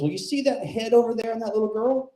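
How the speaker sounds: put-on high, twangy old barfly voice; aunt needling the comic, high and mocking. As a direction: lean in like a leering old aunt. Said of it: "She goes".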